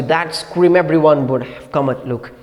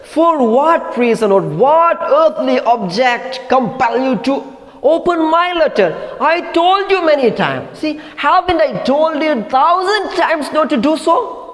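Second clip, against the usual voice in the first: high, indignant scolding of her husband; angry scolding; 9 semitones higher than usual. Acted. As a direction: scold him for opening the letter. Angry husband scolding over letter.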